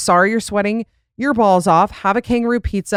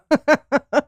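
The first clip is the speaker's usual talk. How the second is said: a short high laugh bursting out; burst of laughter; 7 semitones higher than usual. Real, not acted. Reacting to the caller's accent.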